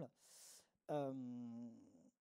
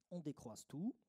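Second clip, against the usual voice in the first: soft, lower calm guiding voice; guided meditation; 3 semitones lower, 3 dB quieter than usual. Acted. Consultant leading the group into meditation, soft and calm. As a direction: ease them into stillness, pausing.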